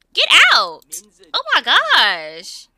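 loud yell then slow exasperated groan; angry shout. Real, not acted. Gamer yelling at Sims to leave, angry shout. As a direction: kick them out, fed up.

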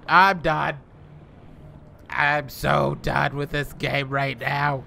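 loud, high frustrated yell; raging gamer. Real, not acted. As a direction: shout out your frustration. Gamer fed up after attack.

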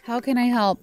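ordinary talk

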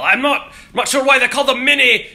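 high, loud comic Scottish yell; yelled Scottish accent. Shown as a demonstration. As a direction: holler it like Mike Myers doing a Scotsman. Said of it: "It only works if you're yelling in Scottish".

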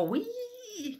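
soft, very high "Wee" of delight; a breathy squeal of joy; joyful whoop. Retold or imitated. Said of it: "just go".

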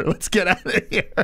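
high laugh over the words. Retold or imitated. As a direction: giggle while retelling. Dad's line, laughing.

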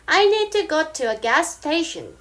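I need to go to a gas station, spoken very slowly in American style; slow American example sentence; slow American demo. Shown as a demonstration. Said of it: "이게 미국 스타일".

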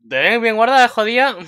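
high, loud mocking girls; teasing gals. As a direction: tease her like cheeky schoolgirls. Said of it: "y dicen". (chicas burlonas, agudo y fuerte)

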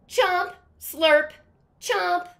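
loud, high greedy chomping crocodile. Acted. Teacher reading aloud as the crocodile, loud greedy chomping. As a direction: chomp it up big and loud like a hungry croc.

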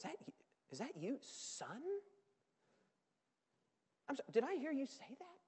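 hushed wonder, gentle and hurt; astonished tender father. Acted. Father answering his ranting son, tender and astonished. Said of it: "look at the astonishment of the father".

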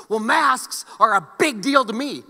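slow, loud, self-important mock rant; hammed-up know-it-all declaring a pet cause; mock soapbox rant. Mocking a soapbox opinion.

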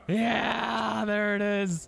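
high, loud cheer over the find; yeah, got it, celebrate; excited. Real, not acted. Gamer cheering at finding a hammer, excited.

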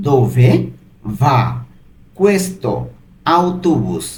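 "Dove va questo autobus?" split into single words with long pauses between them. Shown as a demonstration. Teacher breaks the Italian question into words for learners, slow and separated. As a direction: spell the question out slowly, one word at a time. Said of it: "এর Italian কি হবে?".

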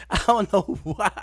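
high and giggling through it; laughter breaking the words; laughing. Real, not acted. Asking himself why the clip is funny, laughing. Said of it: "why that's so funny".

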